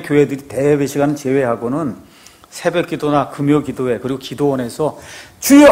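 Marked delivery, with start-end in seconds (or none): 5.4-5.7 loud, high cry to the Lord; mimicked congregational shout, pitched high; imitated prayer cry. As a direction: call out as in communal prayer. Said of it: "하고 세 번 외치는".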